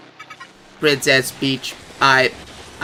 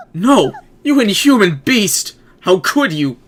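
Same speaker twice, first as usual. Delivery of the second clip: higher, louder outraged princess cry; outraged Peach; 7 semitones higher, 4 dB louder, slower than usual. Acted. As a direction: cry out at the beast, pitched up. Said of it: "my best performance as Princess Peach".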